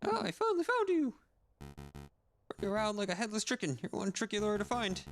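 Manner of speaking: mocking villain. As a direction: sneer at the lawyer you tracked down. Villain finds the lawyer.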